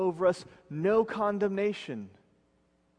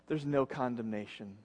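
hushed assurance; 6 semitones lower, 7 dB quieter than usual. Acted. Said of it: "and he's saying".

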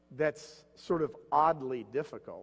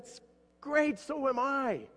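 mock delight; 11 semitones higher, slower than usual. Samaritan delighted, joke build-up.

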